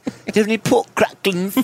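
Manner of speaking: high British accent on pork cracklings. Shown as a demonstration. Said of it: "sounds so funny in a British accent".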